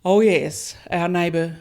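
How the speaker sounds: ordinary talk, nothing marked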